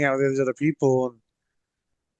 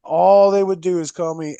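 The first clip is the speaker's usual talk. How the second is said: normal talking voice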